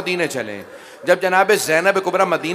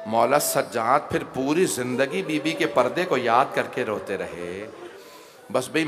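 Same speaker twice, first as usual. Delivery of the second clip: softer, lower pleading supplication; prayer; 5 semitones lower, 6 dB quieter than usual. Acted. Preacher leading the gathering in supplication, soft pleading. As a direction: beseech God quietly line by line.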